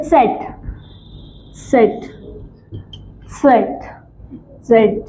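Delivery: सेट begun with a "ts" sound; German letter Z. Shown as a demonstration. Teacher drilling Z for students, सेट as "tset". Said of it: "इसको हम बोलते हैं".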